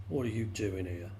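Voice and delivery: low voice, a hint of a big frog; lightly voiced low, suspicious frog; big frog's challenge. A narrator's reading. Big frog confronts the newcomer.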